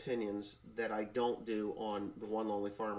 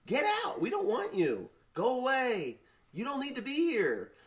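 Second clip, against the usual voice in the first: high-pitched indignant cries; angry dismissal; 11 semitones higher, 3 dB louder than usual. Real, not acted. Man ordering illegal immigrants away, high angry dismissal. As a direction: send them away in a high, worked-up voice.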